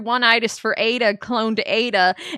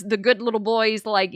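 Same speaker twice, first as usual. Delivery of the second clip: ordinary talk, nothing marked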